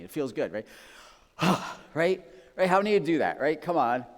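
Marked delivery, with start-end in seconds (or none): 1.4-1.9 loud demonstrated huff; exasperated sigh. Shown as a demonstration. Preacher leading congregation in practice, exasperated sigh. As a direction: heave a pointed, annoyed sigh. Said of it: "practice that with me".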